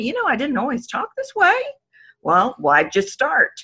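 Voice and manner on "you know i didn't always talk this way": put-on young woman. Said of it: "Or they'll tell me".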